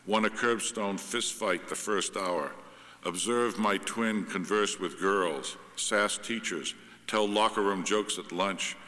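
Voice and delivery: slow, loud poetry recital; poem reading. Acted. Writer reads own poem about twins to audience, measured recital. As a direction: read each line out deliberately, with falling ends.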